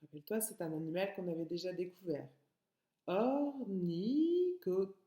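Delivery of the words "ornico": Ornico- very slowly, syllables held; "Ornico-" higher and drawn out; broken into syllables. Shown as a demonstration. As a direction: stretch each syllable of "Ornico-".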